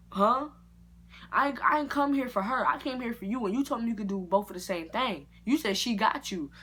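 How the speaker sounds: loud, high indignant huh; indignant protest. Retold or imitated. Disputing the barber's double charge, a loud indignant protest. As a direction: push back, outraged at the bill.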